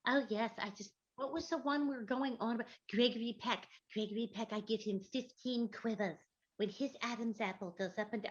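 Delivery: a lightly voiced Gracie Allen; daffy chatter in Gracie's voice. Doing Gracie on the radio show, a hint of Gracie's voice. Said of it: "go, "I'm Gracie".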